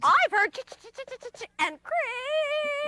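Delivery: high, chattering little chipmunk. Acted.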